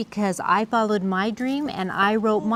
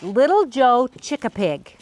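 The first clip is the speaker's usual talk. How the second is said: usual speaking voice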